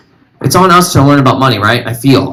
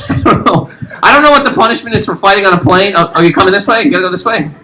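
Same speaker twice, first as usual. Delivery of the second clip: usual speaking voice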